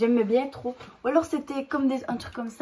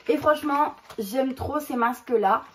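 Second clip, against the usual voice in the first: ordinary talk, nothing marked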